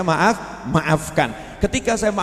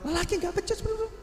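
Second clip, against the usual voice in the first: high-pitched mocking of a nagging wife saying "lelaki nggak becus"; lightly voiced nagging wife; mock scolding wife; 7 dB quieter than usual. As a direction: squeak it out like a sneering wife. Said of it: "Istri yang marah-marah".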